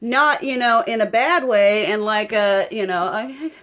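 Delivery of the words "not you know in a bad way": ordinary talk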